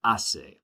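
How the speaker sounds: hace said slowly "ah-say" with a silent H; model pronunciation. Shown as a demonstration. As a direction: model hace without the H, clearly for students. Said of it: "Try it with me".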